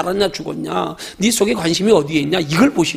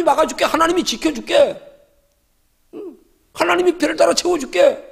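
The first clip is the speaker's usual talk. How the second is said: raised, higher voice of God promising care; a hint of God vowing to protect his child; voicing God's promise; 10 semitones higher than usual. Preacher voicing God's promises to the faithful, raised and higher.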